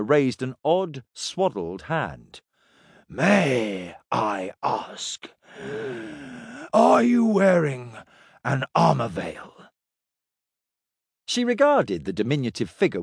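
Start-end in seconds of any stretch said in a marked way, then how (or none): 3.1-9.7 slow, curious little alien voice; a small, odd creature asking politely; small hooded alien. A narrator's reading. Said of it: "the small hooded person said".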